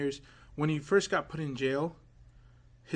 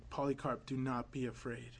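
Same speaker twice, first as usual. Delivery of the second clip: much softer, a hushed voice from heaven; hushed voice from heaven calling to Polycarp; faint heavenly voice; 3 semitones lower, 11 dB quieter than usual. Retold or imitated. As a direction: breathe it softly like a voice from far above.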